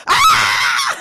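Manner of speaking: piercing high scream breaking into a laugh; hysterical scream. Retold or imitated. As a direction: let out a wild panicked shriek. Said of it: "I was screaming".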